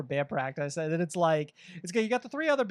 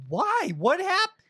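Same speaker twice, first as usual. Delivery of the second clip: mock confusion; 10 semitones higher, much slower than usual. Kicked-out member confused.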